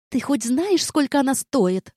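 rising outrage at the cost; indignant. A narrator's reading. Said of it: "возмутилась Изабель".

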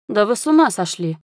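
protesting in disbelief; startled, offended outburst; indignant exclamation. A narrator's reading. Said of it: "воскликнул он".

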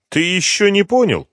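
high, loud sneer; smirking general. Acted. General gloats at Slepnyov, high and loud. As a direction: gloat over the question. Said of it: "ухмыльнулся генерал".

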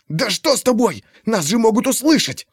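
urgent hushed scolding; hissed rebuke; whisper yelling. A narrator's reading.

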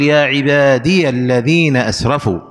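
"عبادي" said ibaadiya, slow recitation; ya with fatha. Shown as a demonstration. Teacher quoting scripture as example, "عبادي" slowly said ibaadiya. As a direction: sound -ya on "عبادي" before "الذين". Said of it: "إثبات الياء مفتوحة".

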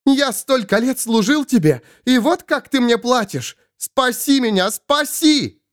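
high-pitched anguished reproach; frantic high begging; desperate plea. Acted. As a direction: cry out high, climbing on the final plea. Svol reproaches and begs rescue.

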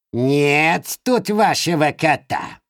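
high, slow scolding crone; cackling Baba Yaga scolding; old witch voice. Acted. (сварливый голос старой ведьмы)

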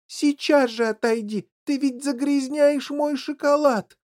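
high desperate plea; imploring. A narrator's reading. Factory owner whose chocolate is being dirtied, imploring. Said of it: "с мольбой в голосе обратился".

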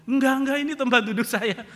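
loud, high mock protest; indignant refusal. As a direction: refuse like a stubborn seat owner. Acted refusal to give up seat. (menolak ngotot, suara tinggi dan keras)

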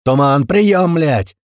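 loud, high, exasperated call; urgent radio shout. A narrator's reading. Gera calling the commander on the radio, urgent shout. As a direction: shout for an answer over the radio.

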